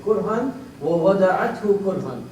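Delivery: recited Quran verse; Quran recitation. Acted. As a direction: recite the verse in a rolling chant.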